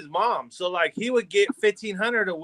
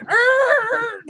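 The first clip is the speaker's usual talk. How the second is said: a sudden high, loud cry of outrage; startled angry yelp; 5 dB louder than usual. Retold or imitated. The foreman yelps in shock at his son's secret stash.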